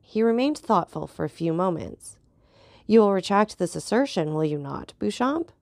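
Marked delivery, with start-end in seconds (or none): none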